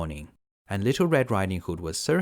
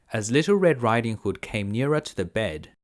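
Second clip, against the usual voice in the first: ordinary delivery, nothing marked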